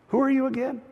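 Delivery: politely baffled, voice lifted; retold puzzled query. Ship's officer questions the stranger in the captain's chair, puzzled.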